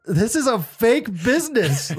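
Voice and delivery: laughing through the words. Retold or imitated. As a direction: laugh as you say it. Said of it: "I laughed at her".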